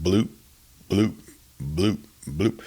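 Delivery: imitated water bloop. A narrator's reading. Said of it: "that now familiar sound".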